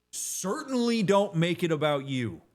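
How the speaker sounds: stern slow warning; firm emphasis. Real, not acted. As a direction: drive the point home hard. Emphatic advice to viewers.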